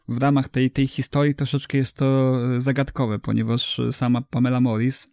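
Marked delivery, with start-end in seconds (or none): none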